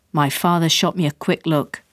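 ordinary delivery

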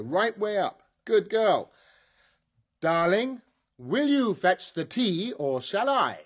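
loud, slow, bossy orders; loud commands. Acted. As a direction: drill the robot with loud orders. Ordering robot about.

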